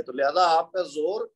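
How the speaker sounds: usual speaking voice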